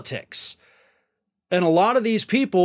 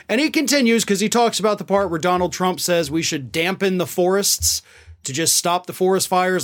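ordinary delivery

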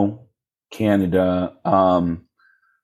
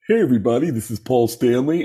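raised-pitch rock frontman greeting; 3 semitones higher than usual. Retold or imitated. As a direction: imitate a rock star introducing himself. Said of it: "do your impression of me".

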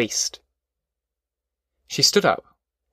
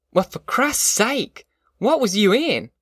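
put-on higher, slow, incredulous young man; lightly voiced Al; 9 semitones higher, slower than usual. A narrator's reading. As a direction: Al waking up, startled by Tom's face. Al waking, voiced by narrator.

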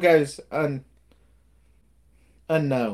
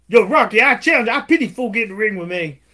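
loud, higher, fast taunting challenge; Clubber Lang taunt; 6 semitones higher, 6 dB louder, faster than usual. Retold or imitated. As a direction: heckle him from the crowd. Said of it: "And he's like".

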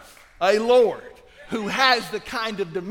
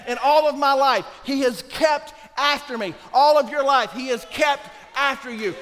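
much louder and higher, full force; preaching shout; 8 semitones higher, 8 dB louder than usual. Real, not acted. Preacher to his congregation, shouting with full force. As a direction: drive it home to the crowd.

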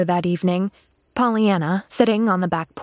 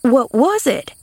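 higher, pressing question; eagerly insistent; 5 semitones higher, 4 dB louder than usual. A narrator's reading. Maid pressing the girl for the secret, insistent. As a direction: demand it eagerly. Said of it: "Nancy was eagerly insistent".

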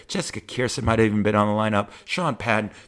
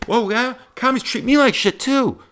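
much higher, louder, overeager squeaky voice; mock eager comic; 13 semitones higher, 6 dB louder than usual. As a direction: parody a needy comic who relates to everything. Plays second eager comic.

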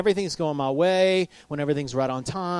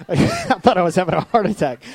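laughing through the line, louder; 3 semitones higher, 6 dB louder than usual. Real, not acted. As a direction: chuckle at his own panic story. Laughing at himself.

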